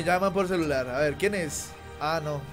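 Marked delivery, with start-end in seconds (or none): none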